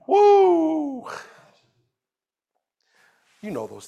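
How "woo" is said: joyful whoop. Acted. Celebrating the boy's faith.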